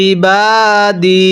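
ইবাদি very slowly, দি drawn out; slow recitation. Shown as a demonstration. Teacher recites for students, very slow. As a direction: stretch দি in ইবাদি. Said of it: "এক আলিফ টান দিব".

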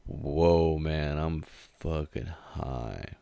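slow stretched-out stoner drawl; stoned drawl. Retold or imitated. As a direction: draw it out dazed and slow. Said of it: "still kinda like".

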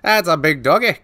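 hint of Scottish again on the dog; Scottish accent. Spotting the big dog, mild Scottish accent. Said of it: "Why am I trying to be Scottish?".

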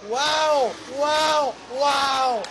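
loud amazed exclamations. Real, not acted. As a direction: shout your disbelief. Stunned by surprise race result.

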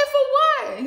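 high, loud shocked cry; outraged disbelief. Retold or imitated. Arrested woman demanding the reason, loud high shock. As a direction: yelp it in total disbelief. Hearing about her warrant.

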